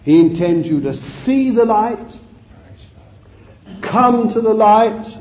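ringing call with long pauses; solemn, spaced-out pulpit summons; preacher's appeal. Real, not acted. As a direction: proclaim each phrase on its own. Preaches appeal in separated phrases.

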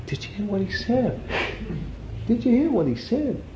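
very high, incredulous put-on voice; shocked disciples. Disciples asking each other what he said, high-pitched disbelief. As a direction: pitch it right up, aghast at the words.

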